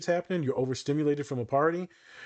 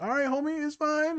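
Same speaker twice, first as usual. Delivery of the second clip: mock reassuring. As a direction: talk them down like a calm counselor. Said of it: "And I'm like".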